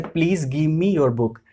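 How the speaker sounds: very slow, word by word; slow example sentence. Shown as a demonstration. Teacher reading an English example to Hindi students, slow and clear. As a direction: read the model sentence slowly for learners. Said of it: "अब जैसे यहाँ पे sentence है".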